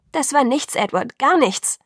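pleading, emphatic denial; urgent protest. A narrator's reading. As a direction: protest insistently, voice rising. Bella protests, pleading with Edward.

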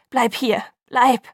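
loud, urgent plea; desperate outburst. A narrator's reading. Jacob begs Bella to stay, desperate and loud. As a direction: push each word out forcefully. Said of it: "stieß er hervor".

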